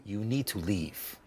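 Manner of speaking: low, quiet, controlled refusal; stern shopkeeper. Acted. Shopkeeper turning away unwelcome customers, low and stern. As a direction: dismiss them in a low, soft voice. Game master voices shopkeeper.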